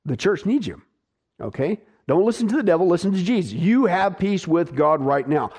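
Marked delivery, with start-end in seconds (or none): none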